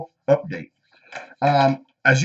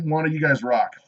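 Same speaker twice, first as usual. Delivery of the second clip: usual speaking voice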